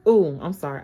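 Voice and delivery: usual speaking voice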